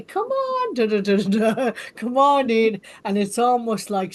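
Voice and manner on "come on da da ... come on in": sing-song greeting, much higher at the start, then louder; hammed-up welcoming shopkeeper, laughing through it; bright mock welcome to a stranger at the door; cheery welcome. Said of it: "somebody like you to say".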